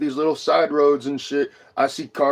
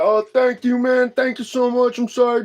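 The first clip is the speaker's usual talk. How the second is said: much higher, sheepish apologetic voice; mocking the other driver; 9 semitones higher than usual. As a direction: play the guilty driver grovelling. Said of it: "And he was like".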